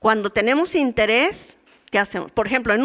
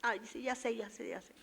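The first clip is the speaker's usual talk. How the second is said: much softer, dismissive mock mumble fading away; bored brush-off; 7 dB quieter than usual. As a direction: imitate someone waving off the Bible. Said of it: "Apatía". (desganada y despectiva, cada vez más bajo)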